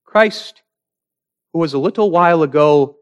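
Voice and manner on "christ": ordinary talk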